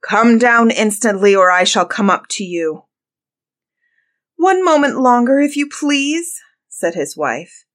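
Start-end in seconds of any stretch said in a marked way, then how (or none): 0.0-2.8 raised, commanding order; loud shout. A narrator's reading. Bluebeard ordering his wife down from upstairs, loud shout. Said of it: "as loud as he could". 4.4-6.4 high, urgent plea for delay; pleading wife. A narrator's reading. Doomed wife begging her husband for time, pleading.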